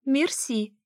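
high, coquettish purr; purring thanks. A narrator's reading. Alisa thanks him for holding the door, high purr. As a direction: purr the thanks with pitch lifted. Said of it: "мурлыкнула она".

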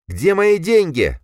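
high, loud, slow anxious voice; nervous demand. A narrator's reading. Дима nervously demands his money from the cloaked men. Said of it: "как-то нервно ответил Дима".

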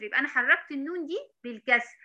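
ordinary talk

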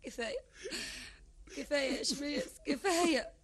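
soft, crying enough; tearful plea. Acted. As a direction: beg him to stop through tears. Wife crying, begging stop.

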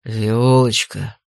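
low, slow, glad greeting; light up with joy; delighted big man. A narrator's reading. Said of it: "Суровое лицо посветлело".

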